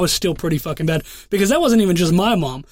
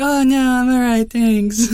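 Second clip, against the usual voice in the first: put-on girlfriend's polite decline; 6 semitones higher, slower than usual. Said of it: "And she's like".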